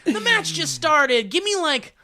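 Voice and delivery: high pleading voice; mock pleading. Gamer begging Jason for a few minutes, high pleading. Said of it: "I'm like".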